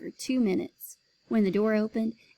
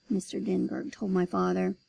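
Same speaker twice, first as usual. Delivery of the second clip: ordinary delivery, nothing marked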